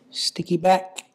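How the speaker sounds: ordinary delivery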